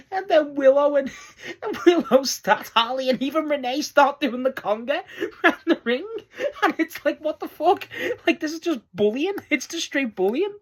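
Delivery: high, loud, breaking into real laughter; laughing while telling. Real, not acted. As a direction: gasp the story out through laughs. Laughing through a cake recap.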